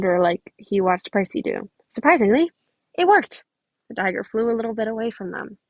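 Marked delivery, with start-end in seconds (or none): 1.9-3.4 surprised. A narrator's reading. Narrator surprised at success.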